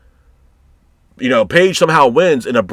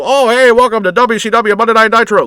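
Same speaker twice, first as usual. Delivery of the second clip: much higher, louder hammed-up wrestling announcer voice; mock nineties announcer; 9 semitones higher, 4 dB louder than usual. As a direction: ham up an old TV wrestling host. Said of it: "still holding on to the glory days of".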